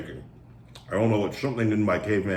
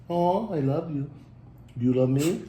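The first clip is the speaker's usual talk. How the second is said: cooing to dog; 5 semitones higher than usual. Real, not acted.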